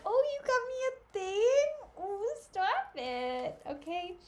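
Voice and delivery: high, drawn-out fake delight; mock gushing Iago. Iago fawning over Emilia's gift in a hammed-up high squeal. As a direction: ham up a giddy thank-you.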